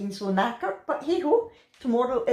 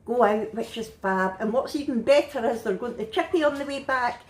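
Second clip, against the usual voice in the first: ordinary talk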